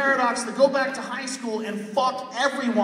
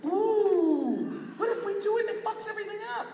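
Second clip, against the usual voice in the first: higher, fast, fretful mockery; mock worried scientist; 11 semitones higher than usual. Comic mocks timid scientists in a higher fretful voice. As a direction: imitate a nervous scientist.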